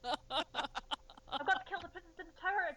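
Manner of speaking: slow, dazed, hapless king voice; bumbling Richard the Third. Retold or imitated. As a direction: play him wide-eyed and clueless. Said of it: "Richard the Third bumbling around".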